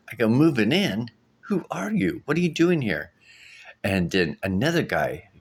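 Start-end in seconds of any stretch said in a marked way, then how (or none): 0.3-3.1 startled, high disbelieving questions; rising alarm at strangers; shocked. Retold or imitated. Shocked at intruders in dream.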